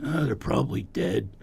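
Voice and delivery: lightly voiced low raspy actor; Don Johnson. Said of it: "He goes".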